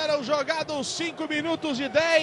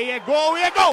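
building goal call; 4 semitones higher, 5 dB louder than usual. Real, not acted.

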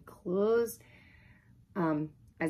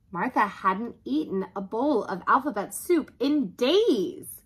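ordinary delivery

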